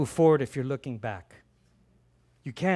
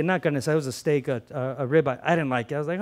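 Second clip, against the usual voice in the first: ordinary talk, nothing marked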